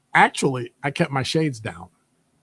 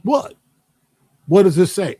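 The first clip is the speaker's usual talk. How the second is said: high sharp "What?", then louder pointed question; confrontational challenge; 5 semitones higher, 5 dB louder than usual. Retold or imitated. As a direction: call them out on ignoring the title. Confronting staff, arms crossed.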